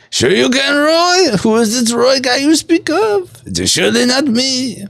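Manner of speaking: very high, silly put-on voice; high-pitched joke character voice; put-on comic voice. Replays chat quote in put-on voice.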